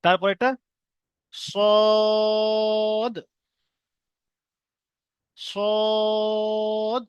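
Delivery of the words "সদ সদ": সদ held long with a rounded mouth; long drawn sad. Shown as a demonstration. Teacher modelling সদ for students. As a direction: stretch সদ heavily. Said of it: "সদটাও তিন আলিফ টান হবে".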